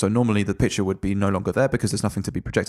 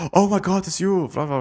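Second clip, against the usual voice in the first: higher, gushing fan voice; starstruck; 9 semitones higher than usual. Retold or imitated. A Nazi soldier greeting the war hero, excited fan gush. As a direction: gush like meeting a star.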